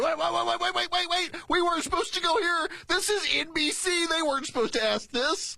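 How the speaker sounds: high, rattled string of waits; mock panic. Host voicing her thoughts during the interview, mock panic. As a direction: blurt it out as a hammed-up inner panic. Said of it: "you can hear the little hamsters".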